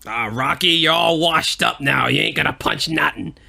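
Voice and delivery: New York tough guy. Pretend. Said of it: "all that New York shit we just did".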